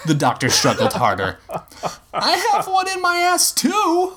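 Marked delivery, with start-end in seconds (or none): 2.1-4.1 very high, urgent yell; high-pitched frantic assurance; strained assurance. A narrator's reading. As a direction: reassure at a high, strained shout. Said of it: "Future Man said, trying to assure him".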